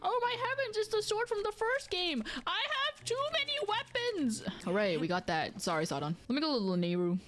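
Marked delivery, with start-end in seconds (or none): 0.0-4.4 very high, thrilled exclamation; excited discovery. Real, not acted. Player finding the first game's sword, high excitement. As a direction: gush in a much higher pitch.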